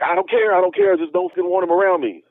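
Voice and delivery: very high, whiny imitation; mock a terrified friend; panicked refusal. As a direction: friend refuses the Bigfoot near him, high mock whine.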